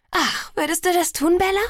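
high, lilting, delighted request; delighted pleading. A narrator's reading. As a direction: gush as if handed a favour. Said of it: "Alice lächelte mich strahlend an".

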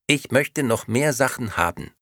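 sweet, empty doll voice; talking doll. Acted. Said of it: "wiederholte die Puppe".

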